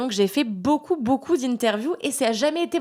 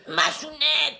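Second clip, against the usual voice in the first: raised pitch, sing-song aunt calling her niece; aunt's voice; 6 semitones higher, slower than usual. Retold or imitated. Aunt greeting her niece, a high imitated aunt voice. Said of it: "elle parle vraiment comme ça".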